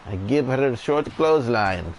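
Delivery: quoting the wrestler with a put-on accent; a put-on accent for the wrestler's line; Iron Sheik impression. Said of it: "That's a part of a story with the Iron Sheik".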